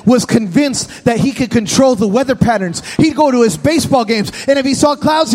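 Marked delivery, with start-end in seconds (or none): none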